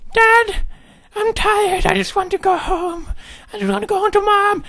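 put-on very high, pleading child voice; tired little son. Son begging Dad to go home to Mom, high-pitched pleading. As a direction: beg in a squeaky kid's falsetto.

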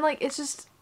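ordinary talk

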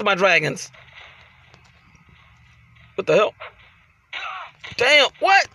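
ordinary talk

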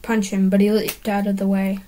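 ordinary delivery, nothing marked

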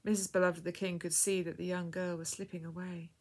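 ordinary delivery

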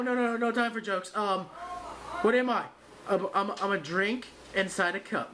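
ordinary talk

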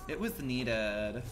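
slow drawl. Real, not acted. Streamer savors the team's save, slowly. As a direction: stretch every word, savoring it. Said twice, first drawn out.

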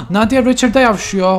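scandalized outburst; shocked gasp. Real, not acted. Shocked at game drama. (şaşkınlıkla yüksek ve tiz sesle)

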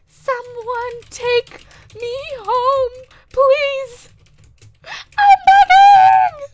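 very high, dragged-out wail; hammed-up begging. Orphan avatar begging someone to take her home, hammed-up wailing. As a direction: beg theatrically, building to a loud mock shriek.

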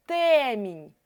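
ТЕМІНЬ with a long stressed Е; наголос на Е. Shown as a demonstration. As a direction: stretch the first vowel of ТЕМІНЬ. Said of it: "Чітко чуємо е". (ТЕМІНЬ з протяжним наголошеним Е)

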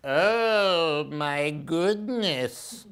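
slow, drawn-out worried owl voice; slow middle brother owl. Acted. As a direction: stretch out goodness, fretting. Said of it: "said Percy".

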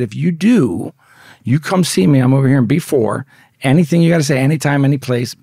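forceful re-enacted call-out; retold challenge. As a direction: lay down a challenge as you retell it. Retold challenge to meet in yard.

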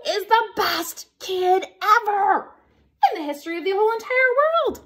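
loud, high, over-the-top praise; gushing proclamation. Acted. Reader praises the kid being read to, loud gushing proclamation. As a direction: proclaim it grandly to the rafters.

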